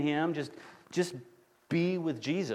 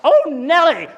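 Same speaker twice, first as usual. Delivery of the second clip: big high yell, much louder; yelled Oh Nelly; 11 semitones higher, 13 dB louder than usual. Retold or imitated. Dad slapping his kid's knee on a road trip, loud yell. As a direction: shout it out while slapping a knee. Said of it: "slap the knee ... and yell".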